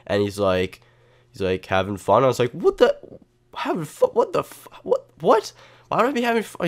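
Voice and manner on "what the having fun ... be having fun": very high, stammered mock outrage; shocked disbelief. Storyteller answering his attacker in the retold scene, high-pitched disbelief. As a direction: squeak out broken-off, incredulous questions.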